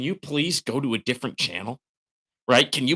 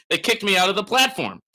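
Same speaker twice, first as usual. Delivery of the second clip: angry; 10 semitones higher, 4 dB louder than usual. Real, not acted. Angry about stolen money.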